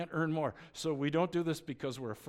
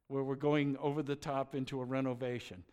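usual speaking voice

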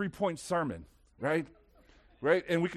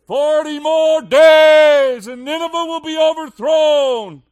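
huge, high, slow shouted proclamation; yelling prophet; 13 semitones higher, 19 dB louder, slower than usual. Acted. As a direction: bellow it across the whole city. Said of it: "No, he's yelling out".

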